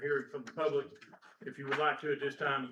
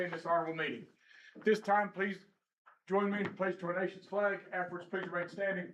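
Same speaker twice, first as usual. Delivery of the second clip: booming court crier announcement; formal ceremonial call to order; grand rich voice; 3 semitones higher than usual. Acted. Bailiff opens court-style meeting.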